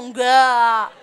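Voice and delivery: loud, drawn-out mock-pious reply; mock-pious answer. Congregation claiming faith to the preacher, loud mock-pious answer. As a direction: imitate a congregation answering all too piously, loudly. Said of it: "Sok beriman semua".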